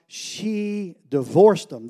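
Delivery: slow, drawn-out mock objection; slowly voiced skeptic insisting she divorced them; skeptic's objection. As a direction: lean on divorced like a doubting listener. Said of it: "And you go, "Yeah, but Josh".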